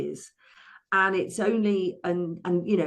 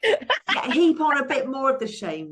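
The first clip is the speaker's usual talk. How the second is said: laughing; 8 semitones higher, 4 dB louder than usual. Real, not acted. Ironic laugh about menopause shame.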